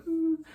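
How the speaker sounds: high little sobbing ooh; a retold cry, voiced lightly; imitating his sob; mock sob. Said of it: "He starts to cry".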